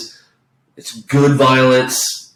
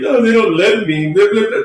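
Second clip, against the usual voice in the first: higher, mocking whimper of a sulking villain; hammed-up self-pity, pitched up; mock whiny crying; 6 semitones higher than usual. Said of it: "then he's on this fucking crying period".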